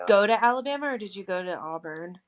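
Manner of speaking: ordinary delivery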